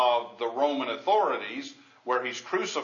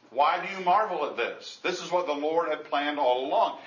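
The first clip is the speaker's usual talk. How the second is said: normal talking voice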